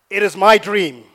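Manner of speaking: put-on loud American-accented quote, punched out. Preacher quoting a Coca-Cola president, in an American accent. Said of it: "That's my American accent".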